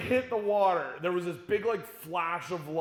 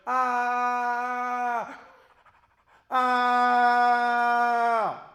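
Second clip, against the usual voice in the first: loud, higher gasps; heaving breaths after the plunge; gasping for breath; 5 semitones higher, 7 dB louder than usual. Retold or imitated. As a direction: gulp air in two big gasps. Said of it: "I had to take two breaths".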